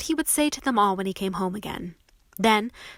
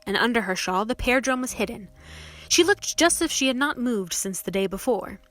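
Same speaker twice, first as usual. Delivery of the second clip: ordinary delivery, nothing marked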